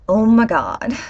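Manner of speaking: put-on parodying Europeans trying an American accent; European's fake American. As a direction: ham up a foreigner faking American. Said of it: "European people were trying to do the American accent".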